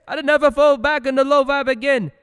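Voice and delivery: very high, loud overexcited pledge; mock vow. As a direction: ham up a naive promise.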